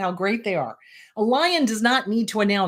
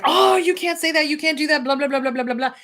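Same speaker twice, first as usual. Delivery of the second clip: higher, scolding critic; inner critic; 7 semitones higher, 3 dB louder, faster than usual. Retold or imitated. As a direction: nag like a bossy inner critic. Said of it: "this thing will pop up and be like".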